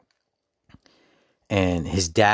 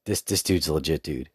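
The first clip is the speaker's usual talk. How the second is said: ordinary talk, nothing marked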